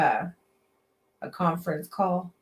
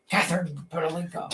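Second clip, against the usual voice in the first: demanding boss; 4 semitones lower, 5 dB quieter than usual. Retold or imitated. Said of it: "you get all demanding".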